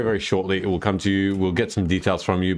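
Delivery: normal talking voice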